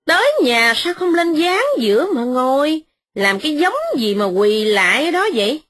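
high, fast biting mockery; wife mocking the kneeling servant; sharp sarcasm. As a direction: needle him with false politeness. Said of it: "mợ Hai nói gai gắt".

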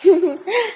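put-on high, bright girlish laugh. A narrator's reading. Young woman laughs to soften the moment, bright. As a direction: laugh warmly at him. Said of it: "Cô ta cười tươi".

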